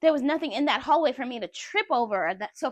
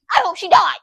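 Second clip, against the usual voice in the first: spiteful quote; 7 semitones higher, 8 dB louder than usual. Retold or imitated. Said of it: "Like, she".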